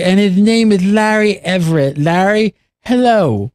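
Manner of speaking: high, lisped goofy host voice; lisping put-on voice. Host opening the show for listeners, high lisping put-on voice. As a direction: lisp it with the tongue out too far. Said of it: "talk with that lisp".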